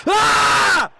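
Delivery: high, loud scream of frustration; frustrated scream. Real, not acted. Gamer after conceding a late equaliser, screaming. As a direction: let out a raw yell. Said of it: "Sorry, I'm frustrated".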